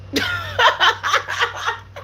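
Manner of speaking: high-pitched burst of laughter; shrieking laugh mid-story. Real, not acted. Laughs at climax.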